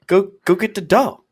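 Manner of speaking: put-on high, slow toddler voice; insistent little kid asking for the dog; toddler demand. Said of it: "he's like".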